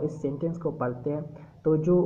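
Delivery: usual speaking voice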